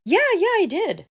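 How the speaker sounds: high, loud eager yeah yeah; overeager reply. Acted. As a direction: oversell it so he won't suspect. Overeager cover-up to father.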